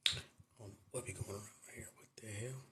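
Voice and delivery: confused whisper to himself. Real, not acted. Muttering to himself while fumbling with the stream, whispered. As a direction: breathe it under his breath, puzzled.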